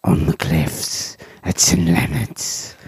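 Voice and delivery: very low, slow croaky whisper; hammed-up creepy narrator; spooky storyteller. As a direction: intone it like a mock horror tale. Mock opening of creepy ghost story.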